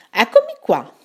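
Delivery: showy owl voice stepping out of the dark; owl appearing. Acted. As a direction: announce yourself proudly as the owl. Owl reveals himself.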